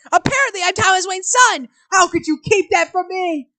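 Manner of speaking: loud, agitated outburst. Acted. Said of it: "Raising his voice, excited".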